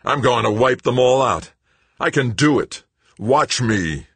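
high, loud, defiant boast; defiant shouting. A narrator's reading. Greg waving a wrench in the air, shouting. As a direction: shout it like a wild threat.

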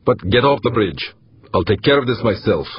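high, sharp angry command; snapped dismissal. A narrator's reading. Commander orders weapons tech off the bridge, snapped dismissal. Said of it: "Harbin snapped".

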